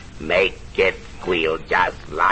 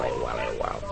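much softer, trailing away; the gremlin's catchphrase, fading; fading repeat; 7 semitones lower, 9 dB quieter, much faster than usual. Acted. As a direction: let it die off. Repeat fades out.

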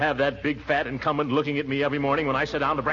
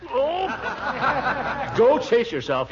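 laughing; 9 semitones higher, much slower than usual. Acted. Laughing at teasing.

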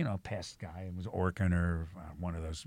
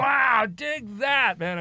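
much higher, very slow hip-cat drawl; mock hipster; 13 semitones higher, 7 dB louder, much slower than usual. Teller reacting to the pest guy, a hammed-up hipster. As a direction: stretch it out like an old jazz hipster.

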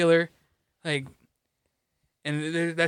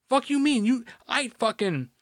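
outraged disbelief; 7 semitones higher than usual. Real, not acted. Venting about the store director, high incredulous outburst. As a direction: pitch jumps high in indignant outrage.

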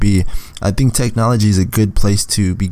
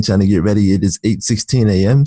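normal talking voice

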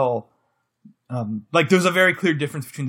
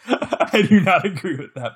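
laughing protest; giggling disagreement; 5 semitones higher, 5 dB louder than usual. Real, not acted. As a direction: say it through real laughter. Laughing at co-host's verdict.

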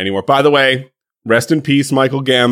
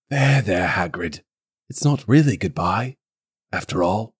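put-on soft, consoling old wizard; gentle comfort; 3 semitones lower, 6 dB quieter than usual. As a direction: comfort him tenderly. Dumbledore comforting.

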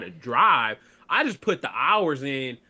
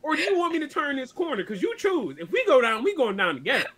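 retold self arguing; 7 semitones higher than usual. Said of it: "I'm like".

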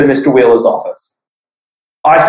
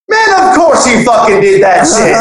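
laughing; 8 semitones higher than usual. Retold or imitated. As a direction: crack up while calling him out. Friend laughing at the denial.

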